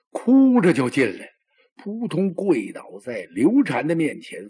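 ordinary delivery

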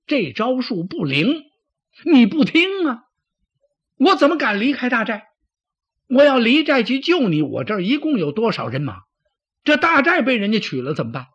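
high, loud, heated protest; indignant retort. Acted. Deputy defends staying to guard the camp, indignant. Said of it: "心里可真有点不大痛快".

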